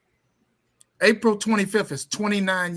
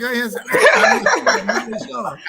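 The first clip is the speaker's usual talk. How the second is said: laughing, high and loud; real laughter as the joke lands; 7 semitones higher, 6 dB louder, slower than usual. Real, not acted. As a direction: shout it out through laughter. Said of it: "laugh shout".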